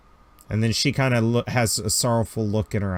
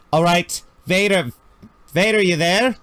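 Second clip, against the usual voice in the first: mock radio call; 6 semitones higher than usual. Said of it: "ever sees them go".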